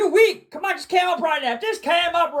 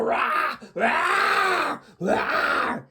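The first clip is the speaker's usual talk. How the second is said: drawn-out harsh bear roar; much slower than usual. Acted. Telling how the poked bear reacted, roaring like a bear. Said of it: "and the bear go".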